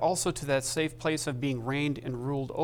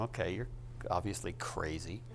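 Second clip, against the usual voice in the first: mock skeptic; 6 semitones lower, 9 dB quieter than usual. Listener mocks believer.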